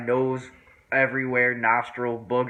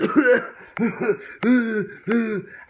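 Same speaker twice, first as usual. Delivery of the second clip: loud, high wheezing hacks; hammed-up choking on smoke; acted coughing fit; 11 semitones higher, 7 dB louder than usual. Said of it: "I'm coughing all over the place".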